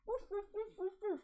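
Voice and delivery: high, rapid-fire woofs; excited run of high barks; dog barking. Acted. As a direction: bark over and over like a dog. Acted dog versus cat barking.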